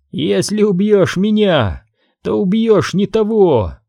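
high, desperate squeal; squealed protest. A narrator's reading. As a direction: pitch jumps up, words dragged out at first. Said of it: "взвизгнул Бен".

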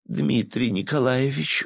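high, slow begging plea; pleading. Acted. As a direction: beg him sweetly. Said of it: "взмолилась журналистка".

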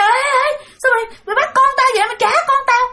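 high, fast panicked cry after the kidnapper; frantic mother yelling. Retold or imitated. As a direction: shout after her, desperate to get the baby back. Mother chasing ghost who took baby. (tiếng mẹ hốt hoảng la lên đòi con)

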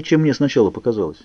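plain reading voice

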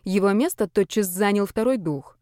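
ordinary delivery

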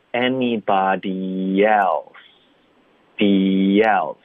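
"anybody else" drawn out with a light y before "else"; light linking y. Shown as a demonstration. Teacher showing the added glide, light linking y. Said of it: "添加一个很轻的一个y的音".

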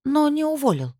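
high, pressing question; high-held note, falling at the end; incredulous question. A narrator's reading. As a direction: ask it pitched right up, in disbelief. Anxious question before sighed answer.

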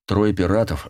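ordinary delivery, nothing marked

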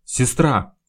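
loud, urgent shout; yelling for help. A narrator's reading. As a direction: call out loudly for medical help. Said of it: "Вместо этого он завопил". (громкий крик о помощи)

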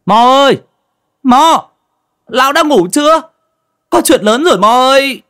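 loud, high-pitched holler through a door; high urgent yelling; calling out. A narrator's reading. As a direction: shout urgently for someone inside. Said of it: "đập cửa gọi với".

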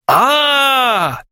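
long, high enraged howl; mock-ferocious drawn-out roar; fierce roar. A narrator's reading. Suitor learns she is clueless, fierce roar. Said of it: "свирепо зарычал Клинков".

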